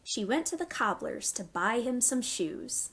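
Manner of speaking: gentle storytime rhyme; reading to children. Acted. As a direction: read it to little ones, pausing for them. Reads a nursery rhyme.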